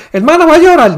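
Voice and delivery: high, teasing fellow-churchgoer voice; mock churchgoer. Mocking a brother who cries during prayer, high teasing voice.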